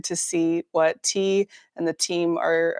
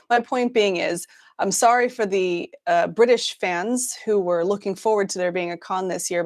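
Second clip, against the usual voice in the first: usual speaking voice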